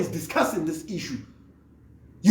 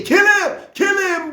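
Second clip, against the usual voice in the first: mock war cry; 9 semitones higher, slower than usual. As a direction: shout it like a cheering crowd. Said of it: "shout out and say".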